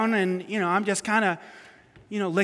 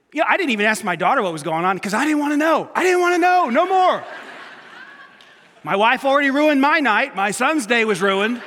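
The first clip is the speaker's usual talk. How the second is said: shouted, much higher comic outburst; mock rant; 5 semitones higher, 7 dB louder than usual. Dad ranting to congregation about ruined night, shouting. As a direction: blow up in exaggerated frustration. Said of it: "Yeah, I'm joking a little".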